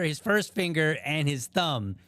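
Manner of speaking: usual speaking voice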